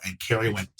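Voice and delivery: usual speaking voice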